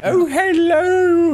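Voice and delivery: put-on high, slow old lady hello; dotty old lady greeting. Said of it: "doing the old lady voice".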